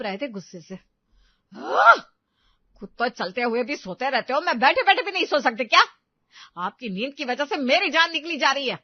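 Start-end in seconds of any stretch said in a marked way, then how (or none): 1.6-8.8 loud, high angry outburst; furious yell. Acted. Wife yelling at her husband for waking her, furious. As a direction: explode at him in fury. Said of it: "वो इतनी जोर से चिल्लाई".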